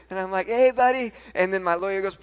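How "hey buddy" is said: high, loud, happy hello; excited greeting. Retold or imitated. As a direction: greet a little boy excitedly. Retold greeting to excited son.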